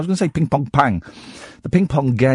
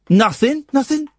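put-on child's voice; 13 semitones higher than usual. Son denies, voiced by dad.

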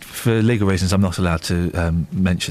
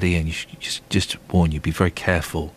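Eamon Holmes; 8 semitones lower than usual. Retold or imitated. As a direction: lower the pitch and space out the phrases ominously. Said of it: "What did you say in your voice?".

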